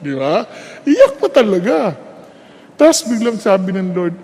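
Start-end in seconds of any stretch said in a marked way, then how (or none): none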